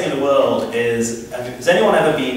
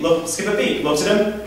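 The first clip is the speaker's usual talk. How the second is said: ordinary delivery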